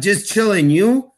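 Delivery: casual reply in a hint of Russian; quasi-Russian accent. Player answering host's greeting at awards, quasi-Russian accent. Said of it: "that quasi-Russian accent".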